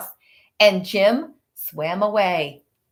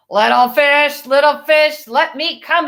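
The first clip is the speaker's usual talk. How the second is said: louder sing-song shark call; 4 semitones higher, 3 dB louder than usual. A narrator's reading. Shark at the wooden ship door, sing-song. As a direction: tease them like a sly shark. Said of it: "roared the shark".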